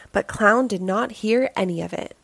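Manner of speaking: usual speaking voice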